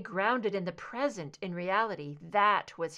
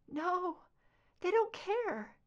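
high, hurt and helpless; whimpering; 11 semitones higher, slower than usual. A narrator's reading. Scully to Mulder, soft hurt whimper. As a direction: let it come out as a whimper. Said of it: "She made a soft whimper".

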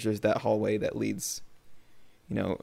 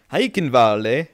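dubious German accent, louder; attempted German accent; 6 dB louder, slower than usual. Shown as a demonstration. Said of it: "was that your German accent?".

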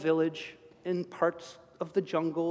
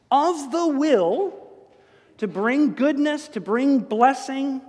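slow, higher, louder stress; emphatic preaching; 6 semitones higher, 5 dB louder, slower than usual. Real, not acted. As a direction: draw each phrase out, higher and louder.

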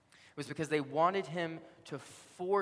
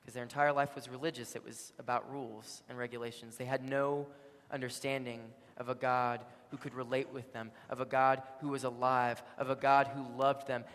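ordinary talk

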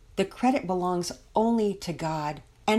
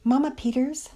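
raised pitch, tentative address; hesitant, slow start; 7 semitones higher than usual. A narrator's reading. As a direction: open carefully, lifting at the end. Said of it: "Missus Belmont began slowly".

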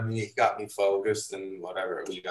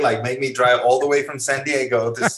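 imitating a put-out photographer, higher and louder; a half-joking, indignant scolding; mock annoyed rant; 4 semitones higher, 4 dB louder than usual. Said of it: "going like".

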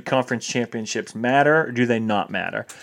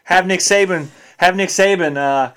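raised-pitch, emphatic Southern fan rant; mock SEC fan; 6 semitones higher, 3 dB louder than usual. Mocking SEC bias to cohost, hammed-up fan rant. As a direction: rant like a die-hard Alabama fan.

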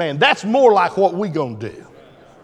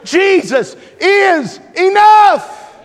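much higher, louder, slow; climactic shout; 10 semitones higher, 6 dB louder, much slower than usual. Real, not acted. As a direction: proclaim it like the peak of the sermon. Preaching climax.

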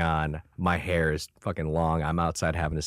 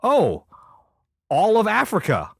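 much higher and louder, impressed; mock amazed; 14 semitones higher, 5 dB louder than usual. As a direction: overdo the wow. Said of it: "reaction to general".

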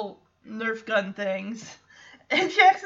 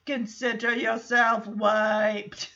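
slow imitated Austrian accent; mock Austrian action-hero voice, drawn out; Arnold Schwarzenegger; 3 semitones lower, slower than usual. Host retelling a show's punchline in an imitated Austrian accent. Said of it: "Then in Schwarzenegger accent".

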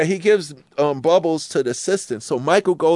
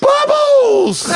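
very high, slow, shrieking scream; 6 dB louder than usual. Retold or imitated. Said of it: "And [name] screams".

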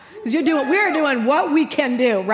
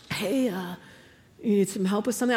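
soft, lower husband voice; imitating a wary husband; tentative husband; 4 semitones lower, 5 dB quieter than usual. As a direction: cautiously offer help. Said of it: "He comes down, he's like".

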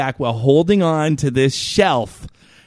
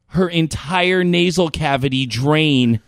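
ordinary talk, nothing marked

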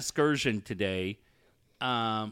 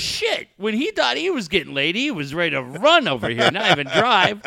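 higher scoffing voice with a laugh; imitating his friend; 5 semitones higher, 5 dB louder than usual. Host voicing friend mocking her ex, higher laughing scoff. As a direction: scoff at him and laugh through the end. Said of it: "She goes".

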